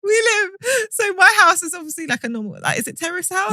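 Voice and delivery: high-pitched talk through laughter; telling the story while laughing hard, voice high. Real, not acted. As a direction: keep laughing as you tell it.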